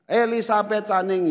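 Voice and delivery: high, loud cashier call; calling out a name. Retold or imitated. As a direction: imitate a clerk summoning the next person.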